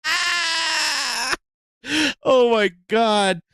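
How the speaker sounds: high howl of laughter; shrieking laugh. Real, not acted. Streamer shrieking with laughter at the clip. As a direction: let out a screaming laugh.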